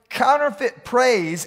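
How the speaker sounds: usual speaking voice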